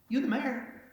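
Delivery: imitating the woman.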